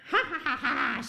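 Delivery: put-on high, loud taunting child giggle; mocking child laugh. Pearl laughing at the minister, high and loud. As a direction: laugh at him like a cheeky girl. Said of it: "She laughs at him".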